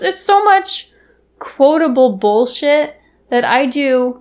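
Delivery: ordinary talk, nothing marked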